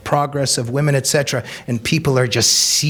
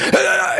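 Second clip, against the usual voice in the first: mock angry shout "ah". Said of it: "comes out and goes".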